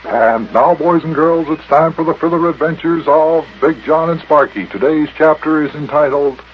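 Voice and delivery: loud, high announcer intro; booming kids show opener. Acted. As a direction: boom out the chapter title.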